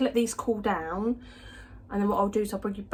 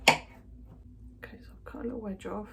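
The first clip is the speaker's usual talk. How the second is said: whisper; 9 dB quieter than usual. Real, not acted. Cutting cookie, hushed commentary.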